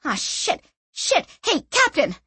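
high alarmed shout; alarmed cry. A narrator's reading. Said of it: "The young woman cried".

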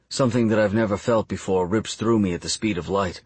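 ordinary delivery, nothing marked

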